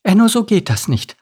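high-voiced earnest mother; serious mother. A narrator's reading. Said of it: "sagte seine Mama ernst".

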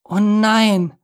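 high, drawn-out groan of dismay; dismayed moan. A narrator's reading. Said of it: "stöhnte Miri".

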